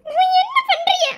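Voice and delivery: wife squeals as her husband tickles her; laughing protest. Acted. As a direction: laugh it out while being tickled.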